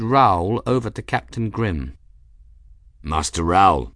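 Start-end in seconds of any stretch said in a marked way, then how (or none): 3.0-3.9 low, solemn captain's greeting; grave. A narrator's reading. Captain bows to the cat who saved him, grave. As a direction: greet him deep and formally. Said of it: "Grimm greeted the cat gravely".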